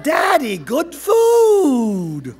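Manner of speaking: put-on high, loud, stretched-out kid voice; eager child's shout. A narrator's reading. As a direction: holler it proudly to dad, drawn out. Child begs father for treats.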